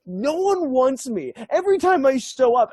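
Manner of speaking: wailing in pretend despair, voice pushed way up; mock breakdown. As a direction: act out a hammed-up self-pitying meltdown. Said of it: "then he has a breakdown".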